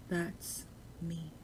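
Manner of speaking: ordinary talk, nothing marked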